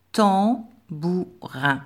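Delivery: tambourin very slow, every syllable drawn out; slow dictation. Shown as a demonstration. Teacher dictating tambourin to pupils, very slow. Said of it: "un mot de trois syllabes".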